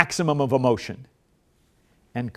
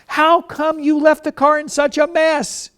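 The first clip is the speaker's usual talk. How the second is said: much higher, louder angry complaint; maximum emotion; 13 semitones higher, 5 dB louder than usual. Shown as a demonstration. Spouse yelling about the messy car. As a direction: explode over the messy car. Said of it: "don't come back in with maximum emotion, which I do".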